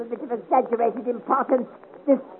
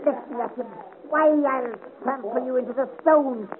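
ordinary talk

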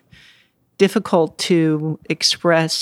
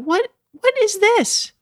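much higher, mock disbelief; incredulous thought. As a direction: squeak it out in disbelief. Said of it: "I just thought".

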